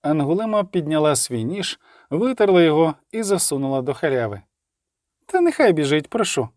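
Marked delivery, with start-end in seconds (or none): 5.2-6.5 mildly raised retort; shouted reply. A narrator's reading. Young woman answers the miners, mild shout. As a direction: call it back, only lightly raised. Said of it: "крикнула вона у відповідь".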